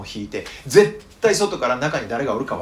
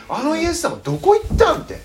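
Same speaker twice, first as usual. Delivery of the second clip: high, loud cry of disbelief; bewildered cry; 5 dB louder than usual. Retold or imitated. Disciples asking where Jesus went, bewildered high cry. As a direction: voice the lost disciples' shock.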